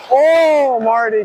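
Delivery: loud, high, long "Oh"; impressed whoop for the goalie; excited cheer. Real, not acted. Player praising the goalie's save, excited cheer. Reacting to Marty's good save.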